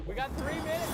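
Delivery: said through laughter; giggling the words out; laughing. Real, not acted.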